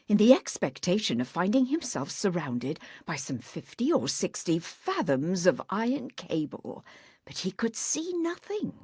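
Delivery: ordinary delivery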